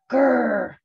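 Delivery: a spoken, storybook "grrr"; fierce little growl. A narrator's reading. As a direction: growl like a tiny mouse acting tough. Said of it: "Little Mouse said fiercely".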